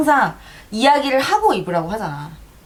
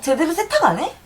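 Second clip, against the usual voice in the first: higher, nagging sister voice; imitating a nagging sister; 9 semitones higher than usual. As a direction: lightly mimic a fussy sister. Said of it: "나 따라하는 거야?".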